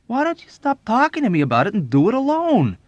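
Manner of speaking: high, loud, swooping outburst; exasperated protest. A narrator's reading. Airman fed up with his friend's talk, exasperated protest. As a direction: snap at him to get on with it. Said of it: "he protested".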